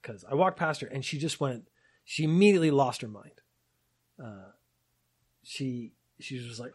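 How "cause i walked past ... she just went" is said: plain reading voice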